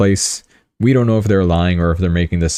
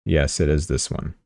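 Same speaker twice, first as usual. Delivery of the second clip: usual speaking voice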